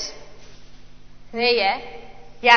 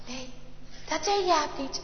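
plain reading voice

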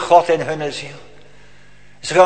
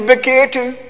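repeated call; 6 semitones higher than usual. Retold or imitated. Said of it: "En dan zegt u".

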